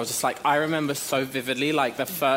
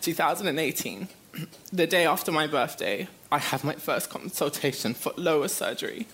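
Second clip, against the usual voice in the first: real choked-up reading, higher pitched; tearful, higher voice, halting; trying not to cry; 4 semitones higher than usual. Real, not acted. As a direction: push on through rising tears until you have to stop. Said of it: "I feel like I'm gonna cry".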